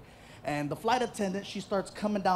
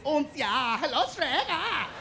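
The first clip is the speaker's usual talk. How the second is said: little German pigs from Shrek; 5 semitones higher, slower than usual. Retold or imitated. Shrek pigs greeting Shrek in German accent. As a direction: put on a high German pig accent. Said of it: "all three little pigs".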